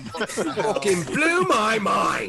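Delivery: high, drawn-out exclamation; mock amazement. Host teasing a friend's revelation, high drawn-out mock amazement. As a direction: stretch it out like a blown mind.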